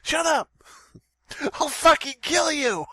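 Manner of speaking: very high cartoon fury; mock rage. Pretend Sora threatening a Twitter user, high mock rage. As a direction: play a squeaky character losing their temper.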